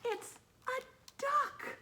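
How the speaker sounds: high, slow, amazed; surprised reveal. A narrator's reading. Reader showing the duck to children, high amazed squeal.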